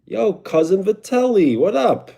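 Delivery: high, loud New York-style hello; excited greeting. Real, not acted. Streamer greets viewer as cousin Vitelli, excited.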